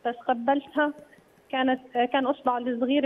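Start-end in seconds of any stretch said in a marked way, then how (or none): none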